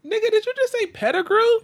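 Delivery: high, loud disbelief; can't believe what he heard; incredulous. Real, not acted. Friend names unexpected tight end.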